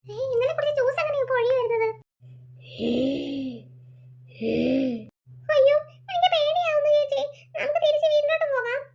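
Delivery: put-on a boy scared of his changed sister; scared little boy. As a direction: beg to go home. Boy frightened.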